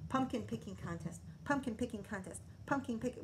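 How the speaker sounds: fast, soft Pumpkin picking contest; tongue twister. Shown as a demonstration. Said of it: "That's a bit of a tongue twister".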